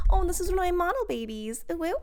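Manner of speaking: a touch of cooing proud artist; gushing model maker. Retold or imitated. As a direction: mimic a fond maker showing off. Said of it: "being like".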